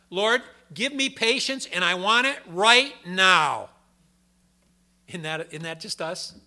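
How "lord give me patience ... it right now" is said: loud, slow, demanding plea to the Lord, higher pitched; hammed-up childish demand for patience right now; mock impatient prayer. Preacher mocks the congregation's impatient prayer, loud and demanding.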